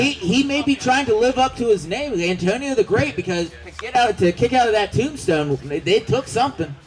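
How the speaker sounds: excited live play-by-play; wrestling commentator. Acted. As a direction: call the match like a ringside commentator.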